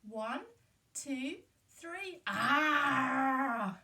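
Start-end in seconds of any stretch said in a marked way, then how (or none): 2.3-3.8 loud pirate "Ah!" shout; pirate roar. Pretend. Leading the children in a loud pirate roar. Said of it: "Great pirate noises".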